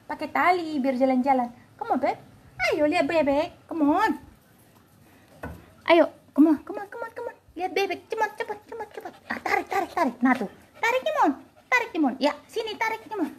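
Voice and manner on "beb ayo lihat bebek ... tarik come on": ordinary talk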